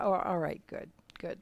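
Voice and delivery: hushed, voiceless reassurance; softly. Retold or imitated. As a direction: let it fade to a gentle whisper. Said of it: "he said softly".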